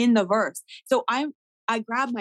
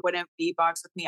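ordinary delivery

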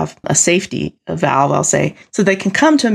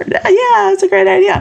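very high, louder forced yes; mock squeaky agreement; 12 semitones higher, 4 dB louder than usual. Ignored creative forcing agreement about stolen idea, high squeak. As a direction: squeak out fake agreement through annoyance.

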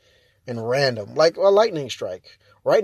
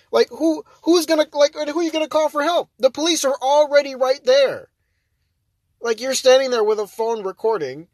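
higher, louder incredulous rant; exasperated rant; 10 semitones higher, 5 dB louder than usual. Real, not acted. Vlogger ranting to viewers about Karens, exasperated. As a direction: hammer the absurdity home.

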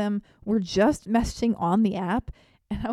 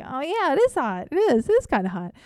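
high, louder eager gush; eager agreement; 6 semitones higher, 6 dB louder than usual. Retold or imitated. Agreeing with a cool girlfriend, eager high gush. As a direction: jump in keen to sound cool. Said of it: "and I'm like".

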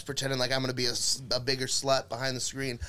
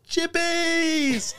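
"Chippies!" squealed much higher and louder; excited Clayton; 5 dB louder than usual. Retold or imitated. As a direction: grab the chips and shriek "Chippies!" with glee. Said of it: "grabs the bag of chips and goes".

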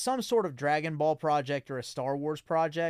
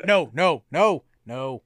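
loud joking refusal; 9 dB louder, slower than usual. Pretend.

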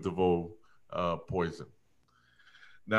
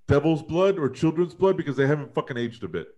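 usual speaking voice

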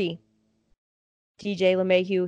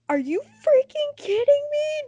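high, slow, crying wail; imitating herself sobbing at the loss; tearful disbelief; slower than usual. Fan retelling the home run loss, high, slow tearful wail. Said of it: "Just crying".

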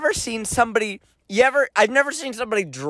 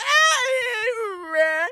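high whimper. Retold or imitated. Reply to mom.

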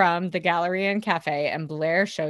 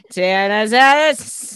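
louder, drawn-out parody; loud slow mock quote; 2 semitones higher, 9 dB louder, slower than usual. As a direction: stretch it out for laughs. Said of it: "She's like".

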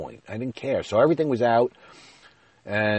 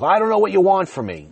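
much higher, louder exasperated wife; not nice; 12 semitones higher, 8 dB louder than usual. Retold or imitated. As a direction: imitate an irritated wife snapping back. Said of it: "she was certainly not nice".

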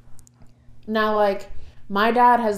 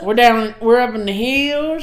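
loud, higher mock Appalachian twang; stretched-out Kentucky hill accent; holler accent; 4 semitones higher, 6 dB louder, slower than usual. Imitating family from Kentucky, thick holler accent. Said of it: "They got holler accents".

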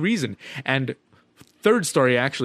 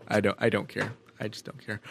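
low, soft, switched-off indifference; checked out; 5 semitones lower, 8 dB quieter than usual. Acted. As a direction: trail down quietly as if the brain shut off. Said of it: "my brain just shuts off".

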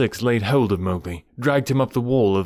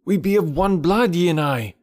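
loud, higher calling out; snake's call; 8 semitones higher, 3 dB louder than usual. A narrator's reading. Mowgli calling loudly to the hidden snakes. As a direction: call out the master word. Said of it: "giving the snake's call".

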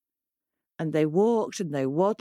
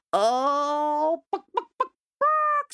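high hen clucks; high clucking hen; 8 semitones higher, slower than usual. A narrator's reading. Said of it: "said Henny Penny".

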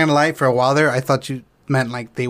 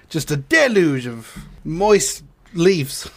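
ordinary talk

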